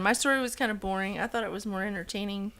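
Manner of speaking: ordinary delivery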